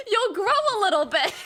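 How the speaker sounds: high, fast, laughing joke. Real, not acted. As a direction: giggle through the words.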